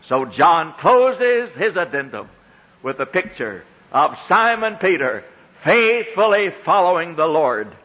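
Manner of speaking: ordinary talk, nothing marked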